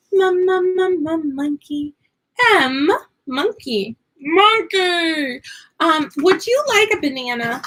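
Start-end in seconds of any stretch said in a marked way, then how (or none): 0.1-5.4 high playful "ma ma ma" landing on "monkey"; playful phonics chant; sounding out M. Shown as a demonstration. Teacher sounding out letter.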